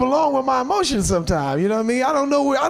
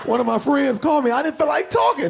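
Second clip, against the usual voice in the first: ordinary talk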